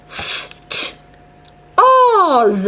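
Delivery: whispered s, then t, then oz; segmenting sounds. Shown as a demonstration. Teacher sounds out stars for the child, s whispered. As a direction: break stars into separate sounds. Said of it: "I heard four of them".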